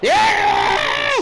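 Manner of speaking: high, loud cheer of joy; triumphant shout. Real, not acted. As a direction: yell it like you just won. Putt drops in golf game.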